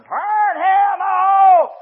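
high, long-drawn mock holler; imitated hollering. Woman wheeled down the hallway, still hollering, imitated. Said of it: "she was still a hollering".

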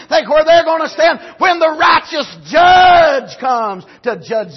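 loud, high preacher's shout; preaching at full force. Acted. Pastor condemning the world's mentality, loud preaching. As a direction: hammer the point home to the church.

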